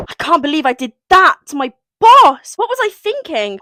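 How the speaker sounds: high-pitched, dramatic disbelief; aghast. Acted. Heroine regretting kissing her boss, aghast. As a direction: exclaim in acted horror at yourself.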